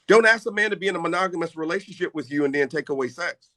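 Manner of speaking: usual speaking voice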